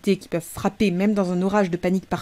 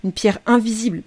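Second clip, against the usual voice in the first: plain reading voice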